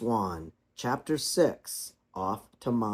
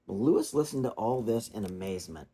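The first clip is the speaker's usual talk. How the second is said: ordinary delivery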